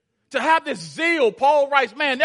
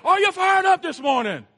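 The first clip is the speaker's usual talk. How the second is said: mock preacher pushing the crowd, higher; louder hype preacher; 7 semitones higher than usual. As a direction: imitate a preacher demanding more energy. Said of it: "You can do better than that".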